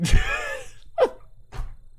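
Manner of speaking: high shrieking burst of laughter. Real, not acted. Laughing at dad clip.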